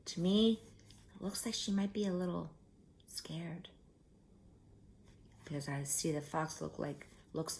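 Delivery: soft whisper, leaning in; whispered aside. Real, not acted. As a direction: whisper it like a secret. Whispering aside about story picture.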